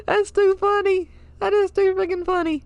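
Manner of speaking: high-pitched, real laughing delight; laughing hard. Real, not acted. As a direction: squeal it through laughter, tickled by the discovery. Cracks up at the pigeon wiki.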